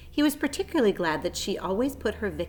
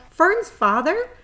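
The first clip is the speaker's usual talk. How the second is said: high, slow, tearful question; sobbing; 10 semitones higher, 8 dB louder, slower than usual. A narrator's reading. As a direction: sob it out in disbelief. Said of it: "sobbed Wilbur".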